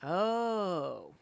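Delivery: ordinary delivery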